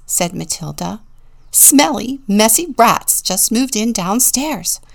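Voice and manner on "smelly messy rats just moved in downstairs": loud, higher voice of complaint; alarmed, indignant pig; disgusted announcement. A narrator's reading. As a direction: announce it with emphatic distaste. Pig announces dreaded rats.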